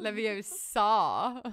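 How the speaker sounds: slow drawn-out Leviosa; Hermione quote. Retold or imitated. She quotes the film line, slow. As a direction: stretch Leviosa slowly.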